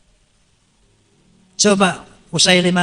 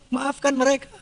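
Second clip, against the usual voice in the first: ordinary delivery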